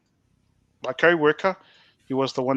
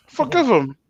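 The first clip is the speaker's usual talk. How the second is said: high, pitched-up disbelief; incredulous echo; 8 semitones higher, 4 dB louder than usual. Retold or imitated. Prisoner asked by pastor to forgive his enemy, incredulous. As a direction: throw it back in disbelief, acting out the shock.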